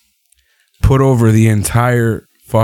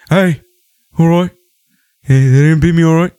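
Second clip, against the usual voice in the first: imitating Pete Dunne; 6 semitones higher than usual. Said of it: "He's like".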